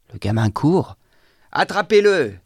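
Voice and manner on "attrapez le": high, raised yell; howled command. A narrator's reading. General sets hounds on the boy, howling. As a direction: cry out the order to the pack. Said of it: "hurle le général".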